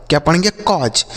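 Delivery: ordinary delivery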